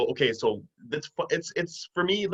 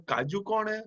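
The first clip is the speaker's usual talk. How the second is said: put-on Indian accent; 7 semitones higher, 3 dB quieter than usual. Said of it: "my grandma in her Indian accent".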